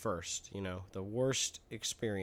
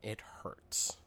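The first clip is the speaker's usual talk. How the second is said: slow, soft mock-pitiful whisper; acted little boy's whispered complaint; pained whisper; 5 semitones lower, 8 dB quieter, slower than usual. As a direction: whimper it to mommy under your breath. Little boy in pain to mommy.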